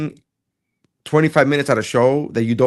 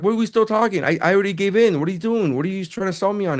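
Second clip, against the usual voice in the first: exasperated retort; 8 semitones higher than usual. Retold or imitated. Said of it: "I'm like".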